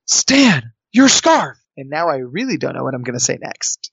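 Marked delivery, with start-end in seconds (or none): 0.0-1.6 high, sudden blurt; urgent exclamation. Retold or imitated. Contestant improvises at the startled producer, high urgent exclamation. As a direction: exclaim it as if stunned, pitch jumping up.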